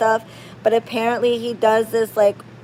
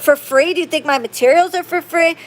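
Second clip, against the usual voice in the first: fast, higher-pitched indignant rant; retold angry tirade, pitched up; going off angrily; 6 semitones higher, faster than usual. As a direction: rattle off outraged questions. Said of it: "If I'm, like, screaming, like, and going off".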